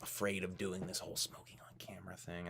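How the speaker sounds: a sly unvoiced aside; mock whisper. Streamer whispering his fear of smoking on camera. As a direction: whisper it like a confession.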